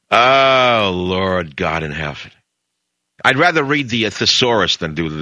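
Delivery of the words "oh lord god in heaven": exasperated groan. Real, not acted. Host groaning to listeners about the news, exasperated and slow. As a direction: let it sag out, fed up.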